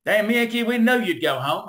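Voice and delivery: mock local taunt. Locals taunt him leaving.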